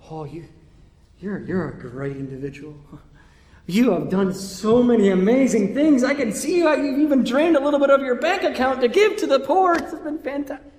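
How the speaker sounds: high, loud run of effusive compliments; mock gushing praise. Preacher imagining God flattering a listener, gushing praise. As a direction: pile on the praise, pitch climbing as you go.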